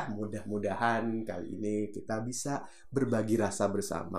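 abrupt drop to slow, soft delivery; suddenly brake into hushed calm. Shown as a demonstration. Bad example of a speaker lurching from fast to slow, soft. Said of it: "terlalu cepat tuh merubah kecepatan".

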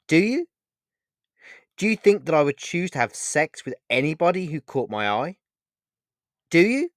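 high, indignant rush of questions; angry. A narrator's reading. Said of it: "said Vega angrily".

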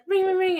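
high, loud ring-ring noise; phone ringing imitation. Retelling the next emergency call, high mimicked ringing. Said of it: "and then it's like".

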